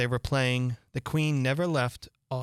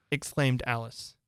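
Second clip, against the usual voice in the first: usual speaking voice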